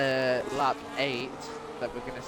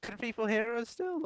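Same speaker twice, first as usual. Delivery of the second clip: voice crack; 11 semitones higher, 6 dB quieter than usual. Real, not acted. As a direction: voice cracking up high. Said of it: "voice crack for me as well".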